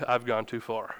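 low, soft retold admission; hushed realization. Husband under his wife's glare, hushed sheepish realization. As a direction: drop your voice as it dawns on you.

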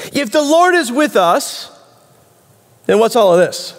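high-pitched, challenging complaint; complaining question. Acted. Gideon answering the messenger of God, complaining. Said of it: "is a complaint in the form of a question".

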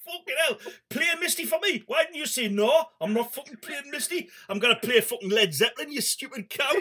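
high-pitched mock Geordie rant; hammed-up Geordie exasperation, building to an insult; exasperated Geordie bloke. Geordie mate telling Clint to refuse the request, mock exasperated. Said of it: "if it was a Geordie bloke".

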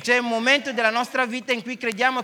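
loud, high interpreter matching the preacher; fervent sermon voice; impassioned preaching. Real, not acted. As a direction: proclaim it at full force. Interpreter preaching at full force.

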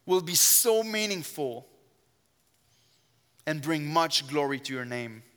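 usual speaking voice

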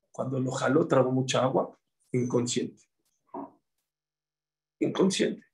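ordinary delivery

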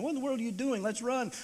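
put-on a frightened kid urging escape; boy watching dad step toward the dog, alarmed; panicked thought. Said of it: "I'm thinking".

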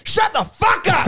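high, drawn-out mock yell; hammed-up furious silencing; angry shout. Acted angry quarrel.

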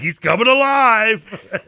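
high, drawn-out holler; mock announcer call. Copying the broadcast call at home, gleeful mock shout. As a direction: holler it like a sportscaster's catchphrase. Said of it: "I'm like".

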